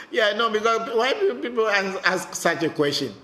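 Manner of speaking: high, laughing words. Real, not acted. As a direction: talk through the laughter. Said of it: "That is why I laugh".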